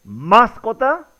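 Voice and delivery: "mascota" slow, stressed on MAS, MÁScota; questioning "mascota" accented on the first syllable, MAS; misplaced stress on MAS. Shown as a demonstration. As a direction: stretch and hit MAS in "mascota", rising as if asking. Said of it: "la pronunciación correcta es".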